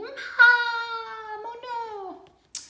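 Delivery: put-on high wailing cry of a toddler; distressed little sister. Little sister wails to Mom after losing her doll battery, high and tearful. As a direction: cry out like a hurt small child.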